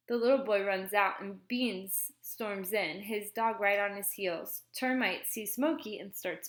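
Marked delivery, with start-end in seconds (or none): none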